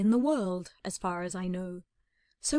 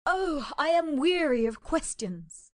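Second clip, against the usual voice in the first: higher, slow, weary and impatient; impatient cry; 7 semitones higher, 4 dB louder, slower than usual. Acted. Miss Cushing tired of Holmes's questions, impatient and weary. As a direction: sigh it out, fed up with being asked. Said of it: "cried Miss Cushing impatiently".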